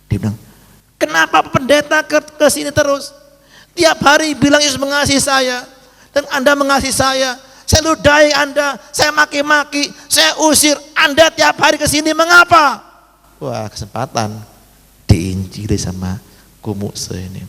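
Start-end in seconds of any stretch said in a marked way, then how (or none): 1.0-12.8 much higher, louder shouting demand; angry prisoner shouting at the pastor who keeps coming; fierce prisoner shouting. Acted. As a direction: yell at the pastor like an angry convict.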